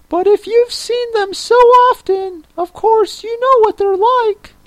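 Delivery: very high creature voice; Mock Turtle. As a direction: squeak it out in a tiny, very high voice.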